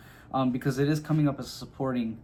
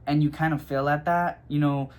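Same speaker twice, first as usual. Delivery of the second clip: ordinary talk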